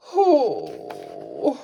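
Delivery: deep, low moaning sigh; low weary bear moan; bear's moan. Acted. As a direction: groan like a sleepless bear. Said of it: "Bear moans and he sighs".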